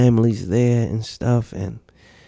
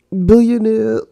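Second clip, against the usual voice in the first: slow, high, loud disbelieving quote; mock quote; 11 semitones higher, 7 dB louder, much slower than usual. Host mocking the clip's title, slow and loud. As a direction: drag out each word in mock awe. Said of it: "But he said".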